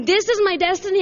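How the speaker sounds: retold exasperation, voice lifted; mock dismay. Bewildered believer protesting a mysterious destiny, mock dismay.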